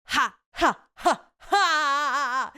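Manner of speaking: loud, high mock witch cackle. Imitating Elphaba's cackle from trailer.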